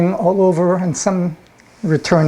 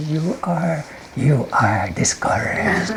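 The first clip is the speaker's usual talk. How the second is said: hammed-up imp whisper; 5 dB quieter, slower than usual. Imp whispering a third time, playfully drawn out. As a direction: draw it out spookily into the ear. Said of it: "I whispered".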